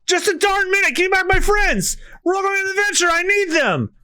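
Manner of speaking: Luffy shouting at whale. Acted.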